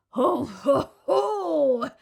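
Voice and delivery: loud booming ho ho; gloating crocodile chuckle. Acted. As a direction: chuckle greedily to yourself. Crocodile laughs to himself.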